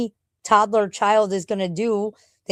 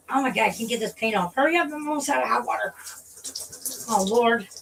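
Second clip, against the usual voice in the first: fast, rushed and high; hurried; 3 semitones higher, faster than usual. Real, not acted. Rushing in the shower as the hot water fails, fast and high. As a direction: hurry before the hot water runs out.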